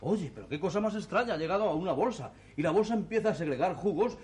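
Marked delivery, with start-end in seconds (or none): none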